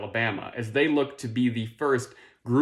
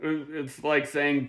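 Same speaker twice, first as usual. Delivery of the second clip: usual speaking voice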